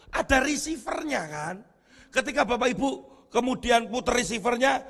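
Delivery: ordinary talk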